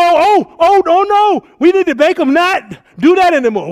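loud, high, scandalised cry; mock outrage. A preacher mocking outraged believers with a loud, high cry. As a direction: gasp in horror, then demand they stop. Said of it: "We need to stand up and go".

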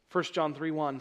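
normal talking voice

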